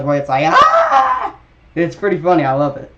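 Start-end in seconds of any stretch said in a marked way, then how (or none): 0.5-1.3 very high, loud goat-like scream. Retold or imitated. Showing the famous meme everybody knows, goat scream. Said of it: "the goat scream that everybody knows".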